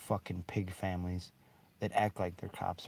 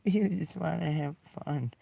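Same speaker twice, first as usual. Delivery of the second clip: high, slow whiny mockery; 10 semitones higher, 5 dB louder than usual. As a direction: whine it like a sulky cop. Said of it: "with whiny voices".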